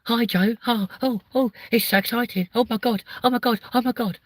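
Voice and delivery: high-pitched, over-excited squeal of a celebrity impression; Stacey Solomon. Comic mimics a TV presenter thrilled with Joe, high excited gushing. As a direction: gush in a high voice with excited little ohs. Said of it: "why the fuck do I choose Stacey Solomon".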